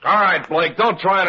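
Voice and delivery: loud, commanding entry; barking order. Acted. Lieutenant bursting into Blake's room, loud and commanding. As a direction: warn the suspect as you storm in.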